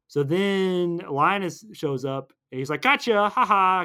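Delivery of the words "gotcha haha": high, loud, drawn-out taunt; mock gloating. Recapping Linus catching the heroes, mock-gloating. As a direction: gloat with a spoken fake laugh.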